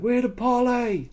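high, slow projected announcement; retold parley call. Player calls truce to enemies, high slow hail. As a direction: sing it out across the field. Calling for parley across distance.

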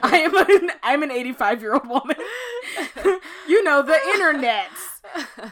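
high, loud laughing through the words; laughing self-mockery. Host teasing herself over an old-fashioned slip, laughing self-mockery. Jokes about talking like old woman.

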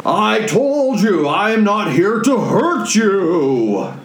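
high, slow protesting cry; Zephyros. Acted. Wizard protesting to fleeing dwarves, high slow cry. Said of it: "you see Zephyros coming along".

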